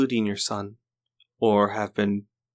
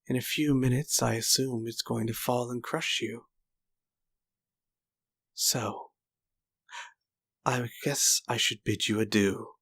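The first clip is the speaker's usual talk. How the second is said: softer, lightly voiced smug old swordsman; a hint of a gloating killer, kept soft; villain's taunt; 3 dB quieter than usual. Old swordsman excusing the killing, soft and smug. Villain bids the widow farewell.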